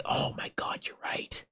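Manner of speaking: hushed, whispered realisation; awed whisper. Real, not acted. Streamer struck by a chat suggestion, whispering. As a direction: let it drop into a whisper.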